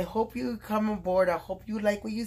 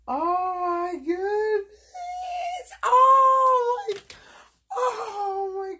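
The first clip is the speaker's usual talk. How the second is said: much higher and louder, near tears with joy; overjoyed cry; 14 semitones higher, 7 dB louder, much slower than usual. Real, not acted. Seeing the gift Mary sent, overjoyed crying exclamation.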